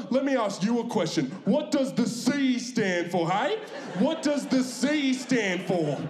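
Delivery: dad grilling the server; flabbergasted dad. Retold or imitated. Dad to KFC girl, high outraged questioning. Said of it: "He was just like".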